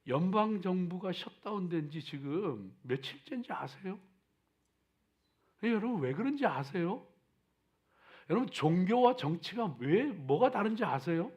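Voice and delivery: normal talking voice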